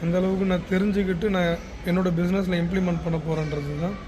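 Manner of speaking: ordinary talk, nothing marked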